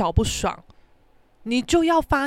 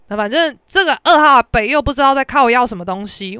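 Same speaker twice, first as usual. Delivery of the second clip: ordinary delivery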